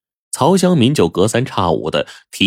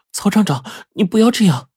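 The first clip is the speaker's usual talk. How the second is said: put-on much higher, shy young woman's voice; 12 semitones higher than usual. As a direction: push him off bashfully. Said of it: "羞涩地说". (羞涩的姑娘声，音调很高)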